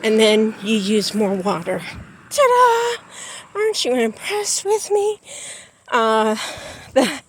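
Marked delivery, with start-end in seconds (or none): 2.3-5.2 high, playful showing off; proud ta-da. Pretend. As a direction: sing it out like a proud reveal. Showing off to viewers.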